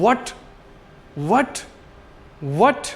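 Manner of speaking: What? rising sharply at the end; rising question tone. Shown as a demonstration. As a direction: ask What? with the pitch sliding up. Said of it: "जिस तरह से हम बोलते हैं". (What? को ऊपर जाती टोन में)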